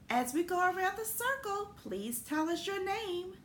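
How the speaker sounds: high, bright teacher voice; cheery teacher voice. Acted. Teacher greeting kindergartners, high and cheery. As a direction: sing it out to the circle. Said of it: "The teacher said".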